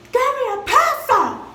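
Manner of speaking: high, loud, slowed-down furious yell; angry demand. Retold or imitated. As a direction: bark the demand at the teacher. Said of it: "I was mad".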